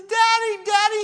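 acted high, loud wail of a panicked boy; hammed-up frightened little son; crying child. Son calls to his father in the storm, crying. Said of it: "and he's crying".

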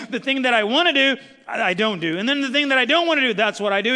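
loud, high, exasperated Paul; Paul at wit's end, loud and high; frustrated Paul. Retold or imitated. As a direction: cry out Paul's struggle. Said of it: "Paul came to the point where he was like".

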